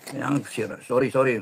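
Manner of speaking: sheepish apology. Real, not acted. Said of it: "Mga kapitbahay ko, mano".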